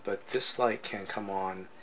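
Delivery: normal talking voice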